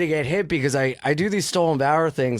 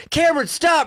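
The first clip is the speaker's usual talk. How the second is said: wife yelling louder; 12 semitones higher, 3 dB louder than usual. Retold or imitated. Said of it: "you hear the wife be like".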